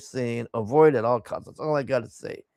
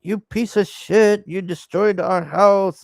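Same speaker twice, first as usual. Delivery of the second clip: louder imitation of an angry telling-off; mock outrage, pitched up at the start; mock bitching out; 4 semitones higher, 4 dB louder than usual. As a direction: chew it out in a retold rant. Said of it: "bitching it out".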